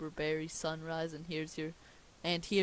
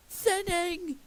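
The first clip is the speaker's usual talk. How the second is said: much higher, loud outburst; indignant shout; 13 semitones higher, 8 dB louder than usual. Acted. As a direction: cry it out in wounded indignation. King corrects the bald remark.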